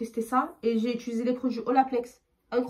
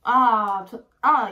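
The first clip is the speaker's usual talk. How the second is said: disgusted outcry; 10 dB louder, much slower than usual. Real, not acted. Reacting to smelly gummy.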